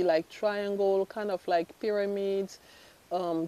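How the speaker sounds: ordinary talk, nothing marked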